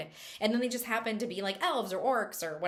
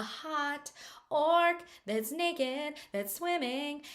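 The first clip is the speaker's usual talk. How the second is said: drawn-out, higher singsong tease; playful reveal; 6 semitones higher, much slower than usual. Acted. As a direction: savour each word, slow and high. Teasing reveal of naked orc.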